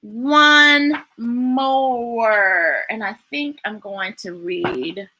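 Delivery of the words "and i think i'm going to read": plain reading voice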